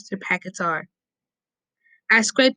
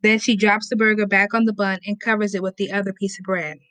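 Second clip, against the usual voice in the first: ordinary delivery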